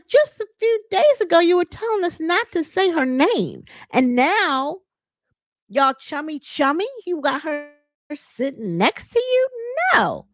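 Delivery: high-pitched indignant rant; outraged high rant. Retold or imitated. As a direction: squeal it in disbelief. Toya objecting at the shower.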